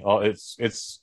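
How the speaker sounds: ordinary talk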